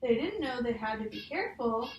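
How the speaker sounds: put-on reading a picture book aloud to a child; measured storybook read, each page leading to the next; story reading. Teacher reading a picture book to a child, storybook voice.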